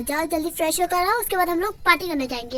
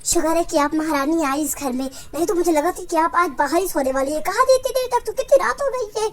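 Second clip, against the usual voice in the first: angry scolding; 4 dB louder than usual. Acted. Mother scolds her child for coming home late, angry. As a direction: scold with rising exasperation. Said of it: "इतना गुस्सा क्यों कर रही हो?".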